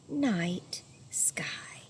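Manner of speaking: soft whisper to close the story; whispered bedtime. Acted. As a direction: hush it like the kids are falling asleep.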